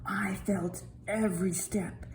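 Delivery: soft awed whisper. A narrator's reading. The brook tells the children in a whisper. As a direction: whisper it with awe. Brook narrator feels steps.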